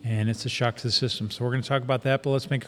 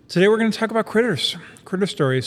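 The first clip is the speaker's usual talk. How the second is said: excited announcer; 7 semitones higher, 5 dB louder, faster than usual. Real, not acted. Announcing the topic.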